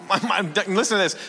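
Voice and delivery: normal talking voice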